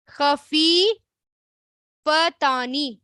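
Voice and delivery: خفیفتانی drawn out very slowly, syllable by syllable. Shown as a demonstration. Teacher repeats the word for students, very slowly. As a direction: repeat خفیفتانی, stretching every sound. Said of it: "اب پھر دیکھیں،".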